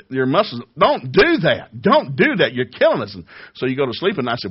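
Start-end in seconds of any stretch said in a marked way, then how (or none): 0.1-3.1 high, loud pleading cry; muscles crying out. Pretend.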